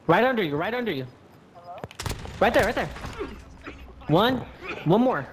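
urgent calls to the teammate; hyped gamer bro. Real, not acted. Calling out the enemy below.

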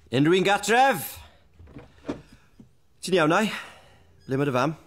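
loud, high call in Welsh. Acted. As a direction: shout through the house in Welsh. Howl calls out in Welsh.

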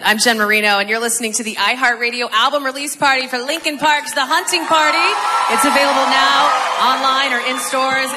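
loud, punchy radio host read; upbeat radio announcer voice. Acted. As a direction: sell the show like a DJ.

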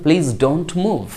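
very slow and careful; slow model phrase. Shown as a demonstration. Teacher showing students the English, very slow. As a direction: spell out each word. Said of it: "তাহলে কি বলবেন?".

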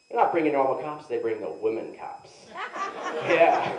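usual speaking voice

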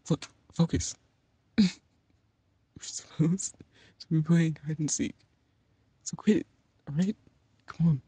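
ordinary talk

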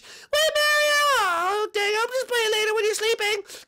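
squealed falsetto wail; Peach. Acted. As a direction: cry out in a very high princess voice, then grumble. Peach left behind by Mario.

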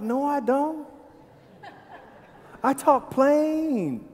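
high, drawn-out Southern protest; hammed-up Southern accent, pitched high; Southern twang. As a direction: protest in an exaggerated Southern drawl. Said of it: "they thought I was a Southern person".

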